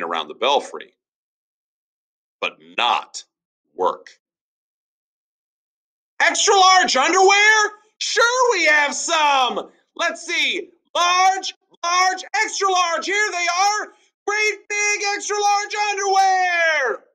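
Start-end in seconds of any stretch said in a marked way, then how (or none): 6.2-17.0 very high, loud bullhorn shout; high shouting Paul. Acted. Noisy Paul shouting to a customer, very high and loud. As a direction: bellow at the shop customer, slowly on the last line.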